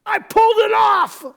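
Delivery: high, loud triumphant crow; gleeful inner thought. Retold or imitated. Husband gloating over his prank, loud and high. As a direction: crow it like a man who got away with it. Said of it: "I'm thinking".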